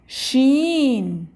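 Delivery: usual speaking voice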